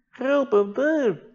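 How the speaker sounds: high, slow, drawn-out whimper; whiny tired plea. Retold or imitated. A tired diver whining to the boat crew, high and slow. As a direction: stretch it out like an exhausted man pleading. Imitating Darius again.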